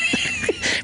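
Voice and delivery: short high squeal of laughter. Real, not acted.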